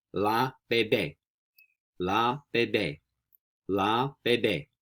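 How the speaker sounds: slow, separated "la bebé", falling at the end; slow model pronunciation. Shown as a demonstration. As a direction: model "la bebé" slowly for learners to repeat. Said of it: "we say".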